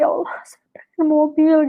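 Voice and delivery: unvoiced whisper, praying privately; whispered inner prayer. Speaker silently praying to Allah for a car, whispered. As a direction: whisper it like a secret wish to God. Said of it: "ngucap dalam hati".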